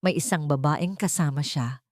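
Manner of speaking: ordinary talk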